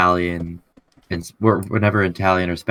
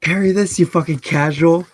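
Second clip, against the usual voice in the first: higher, taunting jeer; mocking taunt; 9 semitones higher than usual. Player's character taunting the duelist, high mocking jeer. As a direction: sneer it like a cocky duelist.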